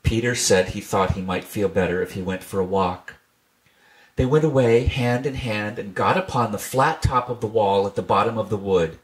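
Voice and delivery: ordinary delivery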